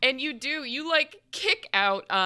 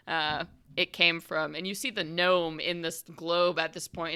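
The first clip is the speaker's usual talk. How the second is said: ordinary delivery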